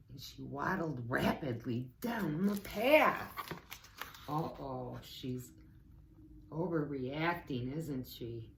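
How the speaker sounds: plain reading voice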